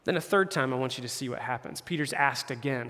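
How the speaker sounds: ordinary delivery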